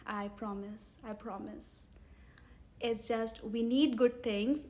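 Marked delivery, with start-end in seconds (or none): 0.1-1.8 quiet, sincere reassurance; soft promise. Real, not acted. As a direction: promise it softly and earnestly. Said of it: "sincere promise".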